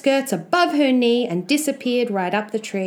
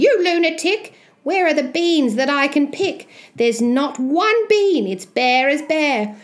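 high-pitched exasperated mother; scolding mother; 6 semitones higher, 3 dB louder than usual. Acted. Mother finding no beans to pick, high exasperated scolding.

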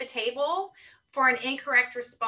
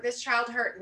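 ordinary talk, nothing marked

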